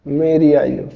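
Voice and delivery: ordinary talk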